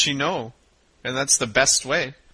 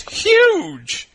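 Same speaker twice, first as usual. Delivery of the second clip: high squeal; 4 dB louder than usual. Acted. Acted line to partner.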